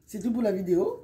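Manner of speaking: ordinary delivery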